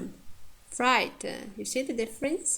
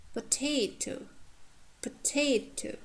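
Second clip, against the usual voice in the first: "potato" higher and careful, stress on "ta"; higher-pitched teaching "potato", strong second syllable; stress on penultimate; 5 semitones higher, 5 dB quieter than usual. Shown as a demonstration. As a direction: lift and lengthen the middle of "potato". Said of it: "second syllable from the end is stressed".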